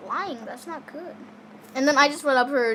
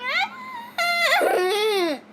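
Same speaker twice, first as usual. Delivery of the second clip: high mock wail of a crying kid; imitated wailing cry. Mimicking the cousin crying in the bathroom, mock high wail. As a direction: wail like the little cousin in the sink.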